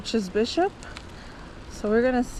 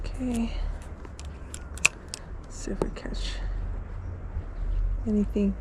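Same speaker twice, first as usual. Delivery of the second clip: whispering; 5 dB quieter than usual. Real, not acted. Quietly casting a fishing line.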